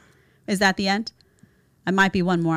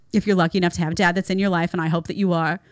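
normal talking voice

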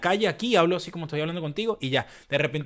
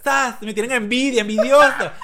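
much higher, louder, slower mock bragging; hammed-up boast; 10 semitones higher, 11 dB louder than usual. Mocking someone who brags about being different, loud and laughing. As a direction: ham up the proud outsider, laughing.

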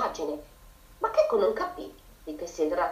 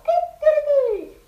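high, slow, loud cock-a-doodle-doo; rooster crow; 7 dB louder, slower than usual. A narrator's reading. Proud rooster crowing at every farm animal. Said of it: "ripeteva il suo grido".

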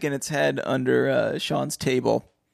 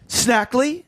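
higher, louder startled question; surprised exclaim; 12 semitones higher, 4 dB louder than usual. Acted. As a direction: snap the name back in shock. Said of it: "exclaimed Fenton Hardy".

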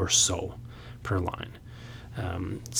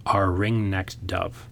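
slow drawn-out line; much slower than usual. Shown as a demonstration. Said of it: "the lack of that syllable".

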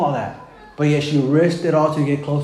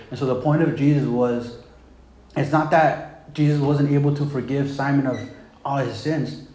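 usual speaking voice

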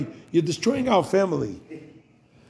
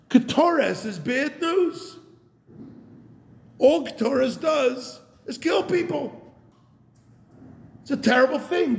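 high, slow whiny grumbling; drawn-out mock lament of the complainers; mock complaint; 9 semitones higher than usual. Rabbi voicing the Israelites' complaint about the Ketoret, high and slow.

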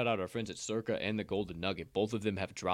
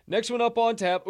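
announcer call; 12 semitones higher, 7 dB louder than usual. Acted. Host announcing next game.

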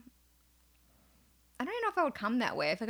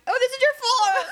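squeaky high mock announcement; high silly voice; 9 dB louder than usual. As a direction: pipe it up high and loud. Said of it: "I'm like".

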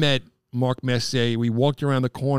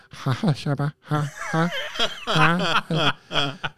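starstruck giggle; 3 semitones higher, much slower than usual. Retold or imitated. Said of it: "looking at Mark Messier going".

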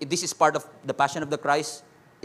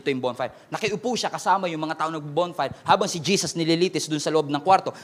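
usual speaking voice

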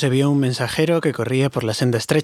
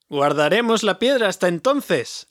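higher, slow shout up the wall; called out; 6 semitones higher, slower than usual. A narrator's reading. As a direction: call across to the king. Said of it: "le gritó Bardo".

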